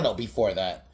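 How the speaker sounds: ordinary talk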